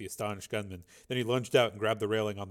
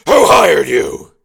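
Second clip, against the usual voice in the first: slow, loud, much louder demand; interrogating shout; 4 semitones higher, 21 dB louder, much slower than usual. Acted. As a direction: bark each word at the dangling man. Dangling gunman off roof.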